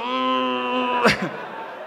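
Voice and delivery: long, steady held groan; drawn-out wordless whine, soft; muffled moan. Acted. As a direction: moan weakly from under the wreckage. Imitates self moaning under the cupboard.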